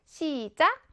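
normal talking voice

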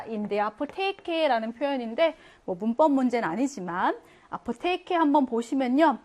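usual speaking voice

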